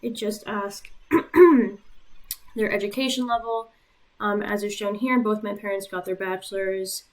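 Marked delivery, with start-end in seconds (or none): 0.9-2.5 loud mid-sentence throat clear; short loud throat clearing. Real, not acted. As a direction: clear the throat, then carry on.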